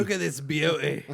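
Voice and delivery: hammed-up Steve Irwin Australian; Australian accent. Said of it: "worst Australian accent".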